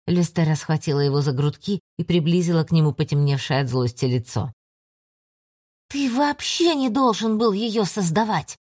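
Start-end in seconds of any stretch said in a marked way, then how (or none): 5.9-8.5 slow, higher growl of fury. A narrator's reading. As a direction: growl it through held-back rage. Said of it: "прорычала она".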